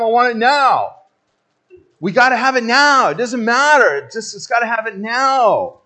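loud, high insistent whine; loud mock insistence; impatient demand. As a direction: demand it right now.

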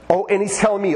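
ordinary talk

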